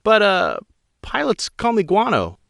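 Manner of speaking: high female pilot voice, tense and pleading; a rattled pilot explaining to an admiral; young woman pilot. Acted. Pilot Guano's voice.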